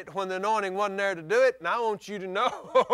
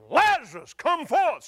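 commanding call; 5 semitones higher, 5 dB louder than usual. Retold or imitated. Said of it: "He said".

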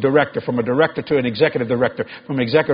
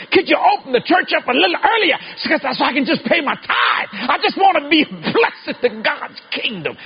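fiery preaching; 12 semitones higher than usual. Acted. Preacher driving home the verse to his congregation, fiery. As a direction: preach it up to the rafters.

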